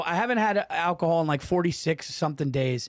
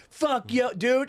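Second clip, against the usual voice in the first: higher-pitched imitation of a frustrated fan; mock fan outburst, pitched up; Ravens fan character; 7 semitones higher, much slower than usual. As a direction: lightly imitate an upset football fan. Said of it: "you were just like".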